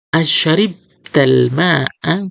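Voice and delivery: Asyaribtal ma'a drawn out slowly; slow teacher reading; slow model question. Shown as a demonstration.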